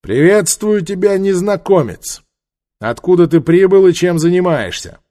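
high, loud call; hailing from afar. A narrator's reading. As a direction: call out across a distance. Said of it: "приветствовал издалека".

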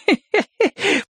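put-on high-pitched drunken woman's titter; tipsy feminine snicker; bursting giggle. A narrator's reading. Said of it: "прыснула со смеху женщина".

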